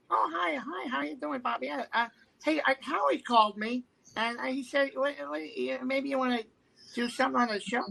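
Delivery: high, stammering talk-show-host impersonation; rambling high celebrity voice; Jay Leno impression. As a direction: keep the pitch up and dither through it. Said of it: "Hey, it's Jay Leno".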